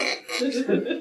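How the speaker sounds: soft, high suppressed snicker; acted stifled laugh. Kid at Mass struck by a silly thought, stifled laugh. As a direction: act out a laugh you're trying to hold in. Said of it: "I was like".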